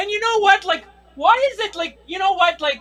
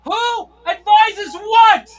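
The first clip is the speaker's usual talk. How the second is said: yelled; 3 semitones higher, slower than usual. Retold or imitated. Yelling at neighbors partying in a pandemic, mock bellow. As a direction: bellow it across the street, stretching the last words. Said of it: "I yelled out".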